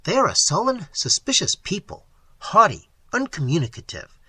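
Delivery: a skeptic doubting every word; incredulous. A narrator's reading. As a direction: challenge her story, pressing hard. Incredulous questioning of waitress.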